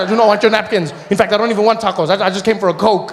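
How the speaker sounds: a loud, quickening refusal of tacos; mock huffy refusal. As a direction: storm off in a huff. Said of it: "I said".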